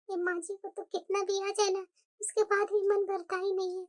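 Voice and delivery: low, soft, slow muttered complaint; grumbling low aside. Acted. Mother-in-law complaining to herself about her son's in-laws, soft muttered grumble. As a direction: grumble it under your breath.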